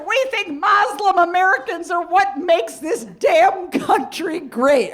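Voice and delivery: high, loud, slow over-gushing liberal mom. Retold or imitated. Daughter mimics her mom fawning over a cab driver's name, gushing high. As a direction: savor the name like a fine wine, way up high. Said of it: "She's like".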